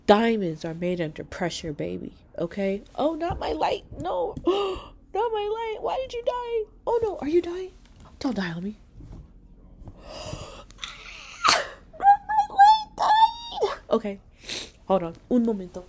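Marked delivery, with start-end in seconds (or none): 3.0-8.8 much higher, hammed-up wailing over the light; over-the-top mock grief for a lamp. Real, not acted. Her selfie light dies mid-video. 10.0-13.8 much higher and louder drawn-out mock shriek; mock anguished shout. Real, not acted. Selfie light finally goes off.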